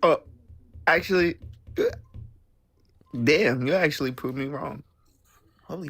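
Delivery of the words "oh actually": usual speaking voice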